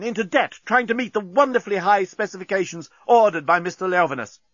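high, impassioned oration over speakers; proud builder demanding justice; defiant speech. Acted. Bolfas addresses mercenaries over speakers.

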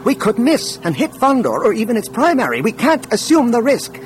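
high-pitched, urgent protest; loud objection. A narrator's reading. Technician warns the control room against firing, loudly. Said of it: "loud enough to be heard over".

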